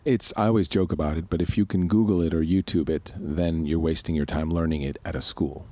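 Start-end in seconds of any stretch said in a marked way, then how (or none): none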